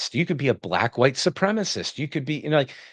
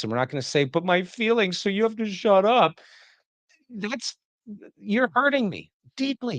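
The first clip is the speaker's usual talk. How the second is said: higher, hammed-up wounded indignation; mock outrage; 4 semitones higher than usual. Podcaster parodying the rabbi to him, mock-wounded outrage. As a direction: parody the offended victim, pitched up and aggrieved. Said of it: "parody of offended rabbi".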